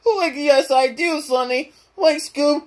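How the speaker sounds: high, defiant cartoon slacker; Shaggy. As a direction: insist in a strained sidekick voice.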